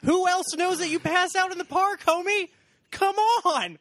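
high, loud exasperated shout at a character; yelling at the screen. Acted. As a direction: shout the obvious twist at the clueless hero. Yelling at movie character Harry.

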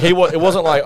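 usual speaking voice

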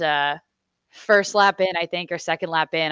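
normal talking voice

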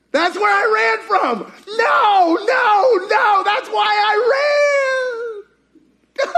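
high, loud, hammed-up wailing protest; panicked Elijah. Preacher playing Elijah refusing to return, mock panic. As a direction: shout it high, stretching out the last words. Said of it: "if I'm Elijah".

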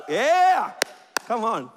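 usual speaking voice

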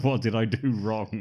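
milder imitation of a wronged child; childlike bewilderment. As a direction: plead it loudly but without yelling. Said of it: "He's like a seven-year-old".

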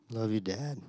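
limp half-hearted love you; weak, limp. Retold or imitated. Forced kid mumbling thanks to dad. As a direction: say it like a dead fish hug, no feeling. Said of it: "very weak".

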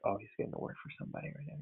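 hushed quick aside imitating wife; a quick, hushed imitation of a wife in the know; quick whisper. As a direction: whisper it fast like a knowing wife. Wife's reaction quoted.

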